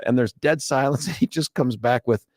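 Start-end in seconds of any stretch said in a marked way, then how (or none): none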